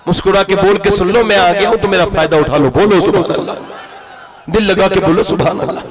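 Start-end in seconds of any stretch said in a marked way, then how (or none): none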